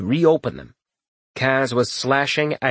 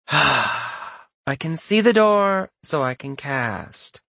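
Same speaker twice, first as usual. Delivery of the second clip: bored mage voice; 4 semitones higher, much slower than usual. Acted.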